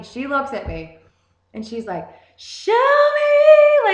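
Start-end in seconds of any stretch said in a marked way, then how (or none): 2.4-3.9 a very high, very loud screech of the hook; mock screeching of the song line; screeching imitation. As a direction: screech it like an awful singer on purpose. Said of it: "the worst fucking screeching noise I've ever heard".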